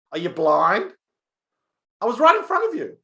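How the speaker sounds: high, loud indignant shriek; shrieking little girl. Acted. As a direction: yell up at him, outraged. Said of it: "the little girl shrieked at Larry".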